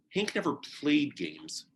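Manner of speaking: usual speaking voice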